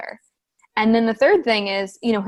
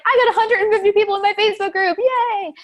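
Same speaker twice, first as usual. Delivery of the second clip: much higher, fast, chirpy mock celebration; mock cheerful brag; 12 semitones higher, 4 dB louder than usual. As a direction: squeak it out like a proud kid. Mocking client's tiny celebration.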